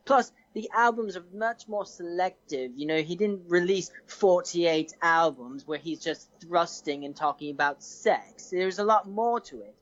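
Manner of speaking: put-on English accent for a mock debate; hammed-up English accent. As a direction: make the case in an English accent. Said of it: "Thirty seconds in an English accent".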